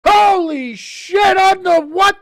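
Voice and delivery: can't believe what just happened; stunned disbelief. Real, not acted. Disbelief after touchdown.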